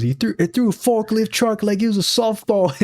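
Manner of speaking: half-hearted British impression of the villain; imitated British accent. Quoting the villain's line in a light British accent. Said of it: "he says it in a British accent".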